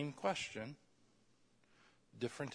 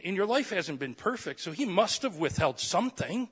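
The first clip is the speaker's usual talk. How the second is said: louder, higher preaching push; full-force preaching; 5 semitones higher, 12 dB louder than usual. Acted. Pastor challenges the congregation on God's goodness, preaching hard. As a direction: drive it home to the congregation.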